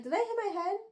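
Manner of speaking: high-pitched bewildered question; mock bewilderment. Heroine baffled by a flower handing her a trinket, mock bewilderment. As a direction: imitate someone doubting their own senses.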